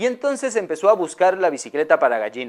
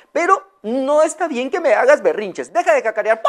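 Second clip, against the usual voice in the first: high, firm scolding; gentle scolding; 7 semitones higher than usual. Acted. Owner scolds her tantrum hen, high and firm. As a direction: tell her off kindly but firmly. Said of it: "le dijo".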